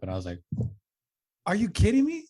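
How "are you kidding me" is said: high, loud disbelieving yelp; incredulous outburst. Retold or imitated. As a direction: blurt it out in shocked disbelief. Angry retort to nurse.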